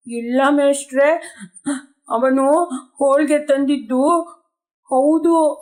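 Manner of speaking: a hammed-up little sob in the voice; sobbing reply. Schoolboy answering the angry teacher, mock sobbing. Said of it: "ಬಿಕ್ಕಿ ಬಿಕ್ಕಿ ನುಡಿದನು".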